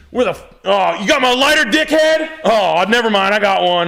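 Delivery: high, loud mock griping; imitated bossy wife. Husband imitating his wife demanding a lighter, high shouted impression. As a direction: holler it like a caricatured nagging wife. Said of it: "she's always fucking giving".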